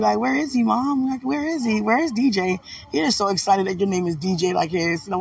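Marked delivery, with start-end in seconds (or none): 0.2-2.6 higher-pitched imitation of eager questioning. Asking Mom where D J is, eager mock questioning. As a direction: act out someone eagerly asking after D J.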